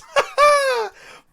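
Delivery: shrieking high laugh of disbelief; loud high laugh. Real, not acted. Laughs at bad hand.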